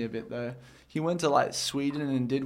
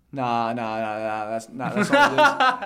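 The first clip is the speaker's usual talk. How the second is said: loud, laughing mock denial of the bill; 3 semitones lower, 8 dB louder than usual. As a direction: laugh through a stubborn refusal. Mock denying phone bill.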